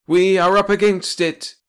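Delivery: high, loud blustering boss; chief Metternich. A narrator's reading. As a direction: bellow like a pompous old chief. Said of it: "he went on".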